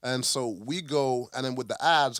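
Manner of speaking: usual speaking voice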